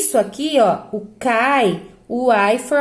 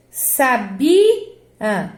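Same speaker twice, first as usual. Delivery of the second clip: "sabia" as sa-BI-a, BI rising and strong; stress on BI. Shown as a demonstration. Teacher testing the stressed syllable, stress on middle. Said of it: "qual é a sílaba tônica".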